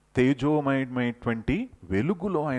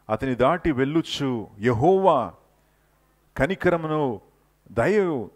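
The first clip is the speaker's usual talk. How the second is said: solemn scripture reading; 5 semitones higher, 5 dB louder than usual. Acted. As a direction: proclaim each name of the Lord.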